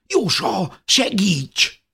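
high, loud call for help; girl begging her sister to help; frightened plea. A narrator's reading. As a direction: cry out for help in panic.

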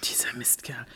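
low, near-whispered swearing; muttered curse. Acted. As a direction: curse him under your breath. Muttered curse, then apologises.

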